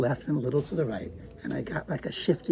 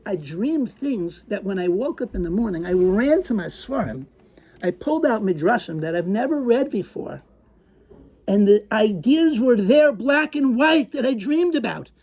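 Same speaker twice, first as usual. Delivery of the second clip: crazed; 4 semitones higher, 3 dB louder than usual. Acted. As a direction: build the madness. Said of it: "a crazed attitude in my voice".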